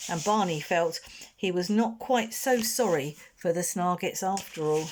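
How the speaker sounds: plain reading voice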